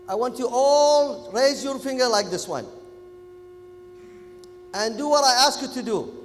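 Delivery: ordinary talk, nothing marked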